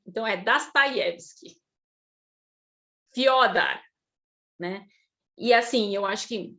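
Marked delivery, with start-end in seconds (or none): 0.4-1.6 Dostoiévski said "Das-ta-IÉV-ski", stress on IÉV; Russian vowel reduction. Shown as a demonstration. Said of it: "tudo vira A". 3.1-3.8 Fyodor as "FIÓ-dar", stressed first syllable louder; Russian vowel reduction. Shown as a demonstration. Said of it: "tudo vira A".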